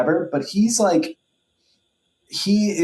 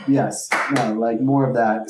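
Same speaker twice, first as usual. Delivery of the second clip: normal talking voice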